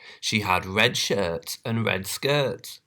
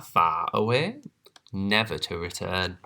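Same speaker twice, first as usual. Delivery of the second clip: plain reading voice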